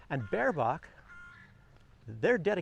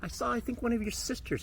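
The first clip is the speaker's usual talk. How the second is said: talking to a cat; 9 semitones higher than usual. Real, not acted. Vlogger greeting a street cat, high pet-talk voice. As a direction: coo at the kitty like greeting a friend.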